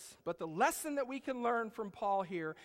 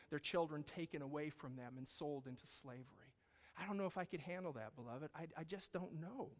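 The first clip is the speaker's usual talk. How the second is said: soft reflective preaching; 6 semitones lower, 6 dB quieter than usual. Real, not acted. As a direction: bring it down to a hush. Quiet reflective close.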